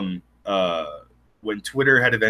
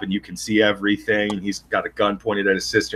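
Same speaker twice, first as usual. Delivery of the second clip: normal talking voice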